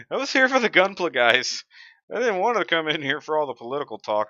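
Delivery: high, loud imitation of a put-out viewer; hammed-up complaining viewer impression; mock viewer voice. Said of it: "what I'm doing with these accents".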